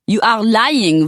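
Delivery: put-on an offended Parisian lady with a French accent.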